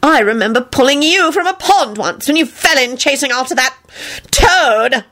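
high, haughty scorn; pompous contempt, pausing before the last word; disgusted. A narrator's reading. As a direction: recall it with snobbish distaste. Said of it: "Percy shuddered".

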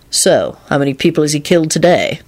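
ordinary delivery, nothing marked

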